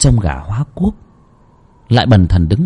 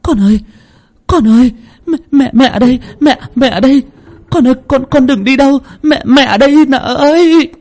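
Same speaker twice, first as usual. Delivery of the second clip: much higher, louder frantic cries; mother shouting into the night; desperate calling; 14 semitones higher, 4 dB louder than usual. Acted. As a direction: call after your vanishing daughter. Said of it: "lao ra ngoài sân mà gọi".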